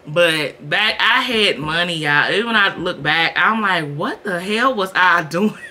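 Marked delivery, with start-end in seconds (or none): 4.0-5.6 laughing through the question; laughing, half amused. Real, not acted. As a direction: laugh at your own past. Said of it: "It's not funny, but it is".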